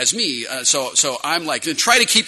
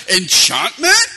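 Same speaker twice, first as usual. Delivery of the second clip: rising quizzical dwarf voice; dwarf question; 5 semitones higher, 4 dB louder, slower than usual. Retold or imitated. Dwarf merchant offering enchantment, quizzical. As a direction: ask it like the game dwarf. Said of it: "the guy that goes".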